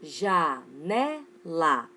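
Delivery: "Janela" very slow, in three drawn-out syllables. Shown as a demonstration. Teacher reviewing three-syllable words for the class, syllable by syllable. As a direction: stretch "Janela" as ja, ne, la. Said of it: "são chamadas de trissílabas".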